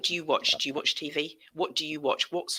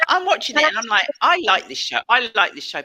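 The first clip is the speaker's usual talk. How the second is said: louder, gushing over the show; hammed-up fan, loud and bright; loud excited mock enthusiasm; 3 semitones higher, 9 dB louder than usual. Host acts out watching excitedly.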